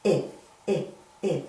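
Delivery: isolated short "I", said as ih; short I sound. Shown as a demonstration. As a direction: sound out "I" as a short ih, one at a time. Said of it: "Short I".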